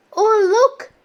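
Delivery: high, loud little gasp of delight; a child spotting something amazing; excited. Acted. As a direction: point and squeal with wonder. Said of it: "said Tiger, pointing".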